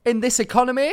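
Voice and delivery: high, loud incredulous question; mock incredulity. Objecting to co-host about bed prices, high incredulous outcry. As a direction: exclaim it as if appalled.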